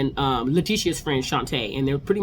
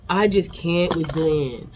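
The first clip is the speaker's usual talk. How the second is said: usual speaking voice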